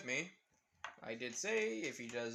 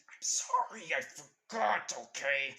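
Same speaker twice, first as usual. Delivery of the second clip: panicked apology; 6 dB louder, slower than usual. Retold or imitated. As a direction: beg forgiveness in a frantic squeal. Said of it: "With Naruto saying".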